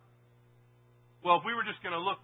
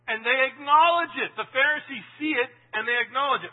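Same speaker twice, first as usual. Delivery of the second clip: louder, higher preaching build; emphatic sermon peak; 6 semitones higher, 8 dB louder than usual. Acted. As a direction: drive it home from the pulpit. Preacher rising to full force.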